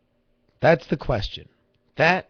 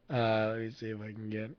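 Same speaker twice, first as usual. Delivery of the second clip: ordinary talk, nothing marked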